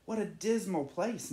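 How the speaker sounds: a touch of a proud bird sighing at the dull land; Nacho's inner voice. A narrator's reading. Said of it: "Nacho thought".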